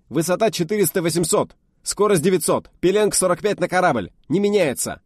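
high, loud snappy report; brisk reply. Acted. Officer reporting to the test leader, loud brisk reply. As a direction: rap it out smartly. Officer calls out target data.

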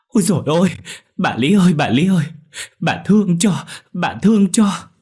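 high, loud wailing plea; tearful begging. A narrator's reading. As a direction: wail and beg for mercy. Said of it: "khóc lóc van xin".

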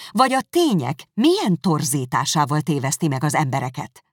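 loud, rising indignant voice; heated accusation. Acted. Elizabeth confronting Darcy over Wickham, loud and heated. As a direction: pile on the accusations.

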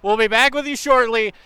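loud, high, fast sports reporter; breathless sideline reporter. Pretend.

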